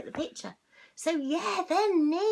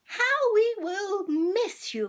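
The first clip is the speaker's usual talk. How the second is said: lightly voiced headmistress; 8 semitones higher, 6 dB louder, slower than usual. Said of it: "the headmistress made a nice speech".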